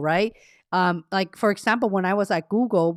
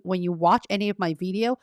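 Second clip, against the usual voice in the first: usual speaking voice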